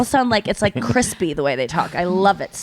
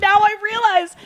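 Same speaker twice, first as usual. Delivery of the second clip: much higher, excited voice; amazed realization; 14 semitones higher than usual. Retold or imitated. Podcaster discovering his lisp, high and amazed. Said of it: "he was like".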